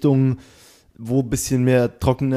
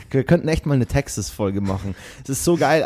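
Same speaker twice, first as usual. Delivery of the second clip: ordinary talk, nothing marked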